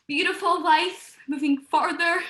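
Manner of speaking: ordinary delivery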